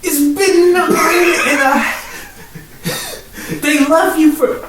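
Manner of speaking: high, slow, laughing sing-song; mock protest while cracking up. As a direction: stretch it out through laughter. Mocking the story, laughing.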